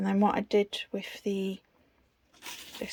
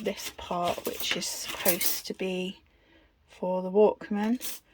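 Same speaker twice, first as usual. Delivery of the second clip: usual speaking voice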